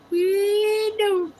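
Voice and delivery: high squeaky kitty meow, playful; cat voice. Pretend. Meowing along with the cat on stream, high cat voice. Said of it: "meowing it up".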